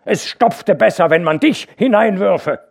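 loud, high cutting reply; biting retort. A narrator's reading. Hauke angrily retorting to the worker. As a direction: hurl it at him.

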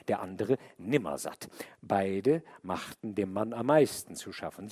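usual speaking voice

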